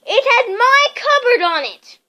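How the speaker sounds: high, loud angry insistence. A narrator's reading. Harry argues angrily with Uncle Vernon. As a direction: insist hotly. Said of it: "said Harry angrily".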